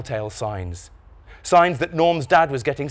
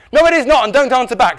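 high, loud scolding; angry telling-off; 11 semitones higher, 9 dB louder than usual. A narrator's reading. Dad scolding Norm for answering back, angry. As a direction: come down hard on backchat.

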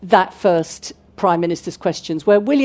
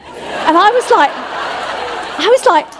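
laughing, much higher and louder; 11 semitones higher, 4 dB louder, slower than usual. Real, not acted. Speaker laughing as she recalls the shock. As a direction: laugh through the words, pitched way up.

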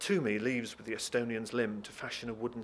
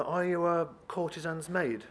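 higher, hesitant English enquiry; Jacob's polite question; 6 semitones higher, 3 dB louder than usual. Acted. Jacob asks the visitor her job, politely hesitant. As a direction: ask it delicately in fluent English. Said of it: "This baffles her".

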